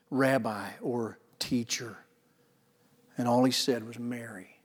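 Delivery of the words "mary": Mary breathed almost silently, very soft and low; a hushed, intimate speaking of her name; whispered tender call. Acted. Jesus softly speaking Mary's name at the tomb, hushed and tender. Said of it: "She knew by the way he had said the name".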